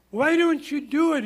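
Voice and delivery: loud, high-pitched urging; urgent exhortation. Acted. Preacher voicing God urging Joshua to obey, loud exhortation. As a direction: push the question out high and emphatic.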